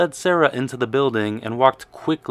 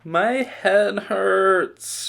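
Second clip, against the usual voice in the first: very slow, higher moan; 8 semitones higher, 3 dB louder, much slower than usual. A narrator's reading. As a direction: drag it out, feeling sorry for herself. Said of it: "she moaned".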